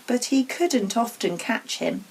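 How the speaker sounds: usual speaking voice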